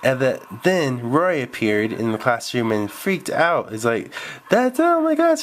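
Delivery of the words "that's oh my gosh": terrified. Acted. Roy sees the deaf boy and panics, terrified. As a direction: freak out at the sight of him. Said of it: "he was so afraid of the deaf boy".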